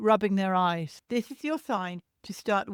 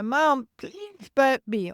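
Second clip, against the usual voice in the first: put-on baby's plea; 5 semitones higher, 4 dB louder, slower than usual. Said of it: "that stage where".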